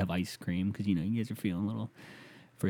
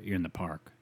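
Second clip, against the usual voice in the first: ordinary delivery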